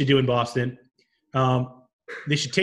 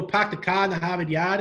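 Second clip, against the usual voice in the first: higher, tentative Boston accent; lightly faked Boston accent, dropped R's; Boston accent attempt; 5 semitones higher than usual. Shown as a demonstration. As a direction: try out a cliché Boston accent. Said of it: "I never really tried it".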